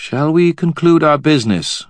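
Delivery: high, imperious queen voice; cold queen Mab. A narrator's reading. Queen to the wizard, haughty and high. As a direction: speak down to them as a queen.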